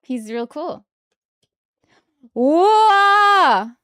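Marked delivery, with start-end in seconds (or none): 2.3-3.7 big loud startled whoa. Real, not acted. Fan pulling a rare card, loud amazed whoa. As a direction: blurt it out in amazement.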